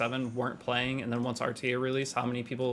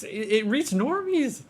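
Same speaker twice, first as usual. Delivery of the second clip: excited; 12 semitones higher, 5 dB louder, slower than usual. Acted. Mock awe at normie reach.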